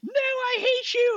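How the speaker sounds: put-on very high, slow, whiny child wail; tantrum kid. Little Timmy screams at his parent leaving the park, shrill tantrum. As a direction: throw it like a screaming toddler.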